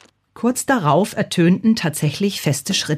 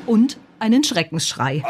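usual speaking voice

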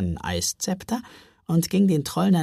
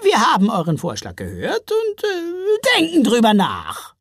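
raised, loud troll voice; grudging troll mother; 8 semitones higher, 5 dB louder than usual. A narrator's reading. Troll mother answering the proposal, raised and noncommittal. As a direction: announce it with a hesitant break.

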